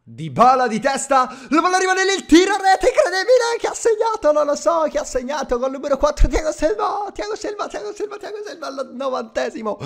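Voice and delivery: high, screaming goal call with laughs; breathless italian goal commentary. Acted. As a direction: scream the late winner like a TV commentator. Last-minute goal call.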